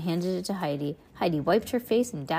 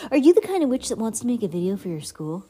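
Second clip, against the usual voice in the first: mock ad announcer; 3 semitones higher, 5 dB louder, faster than usual. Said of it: "ad-style spell reading".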